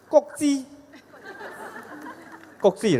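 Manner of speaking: 觉知 said carefully in Cantonese; Cantonese pronunciation. Shown as a demonstration. Meditation teacher tries a Cantonese word on the audience, careful Cantonese pronunciation. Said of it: "I know how to say in, uh, 广东话".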